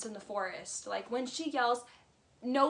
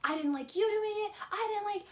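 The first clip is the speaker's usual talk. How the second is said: imitating Ariana yelling; 10 semitones higher than usual. Vlogger imitating Ariana yelling at her boyfriend, high mock yell. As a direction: shriek it like she's at her limit. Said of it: "And she yells".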